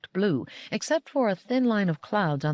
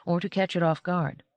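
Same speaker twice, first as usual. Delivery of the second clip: usual speaking voice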